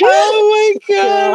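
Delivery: high, slow, laughing cry of delight; laughing shout. Real, not acted. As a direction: burst out laughing on it. Reacting to friend's joke.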